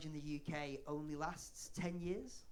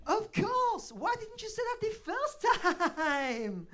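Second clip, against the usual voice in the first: delighted French waiter; 11 dB louder, much slower than usual. Retold or imitated. Waiter mocked, suddenly delighted.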